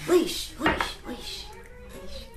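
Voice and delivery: fading echoing repeats; echo effect. Acted. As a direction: echo it away into the distance. Echo effect on segment name.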